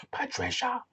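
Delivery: high-pitched cartoon call of a name; Peppa Pig shout. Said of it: "I thought of Peppa Pig".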